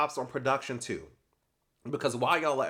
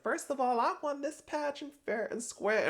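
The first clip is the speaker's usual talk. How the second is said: imitating an offended, prissy protest, pitched way higher; mock indignant Kelsey; 13 semitones higher than usual. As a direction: insist on your honor in a high, huffy voice. Kelsey indignantly defending her pageant win.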